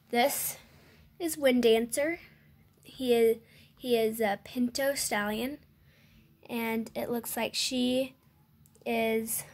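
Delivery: ordinary delivery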